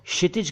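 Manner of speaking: क्षितिज said as "shitij", no k; wrong sh onset. Shown as a demonstration. Teacher quoting others: क्षितिज as "shitij". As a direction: say क्षितिज starting with bare sh. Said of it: "कुछ लोग क्षितिज कह देते हैं".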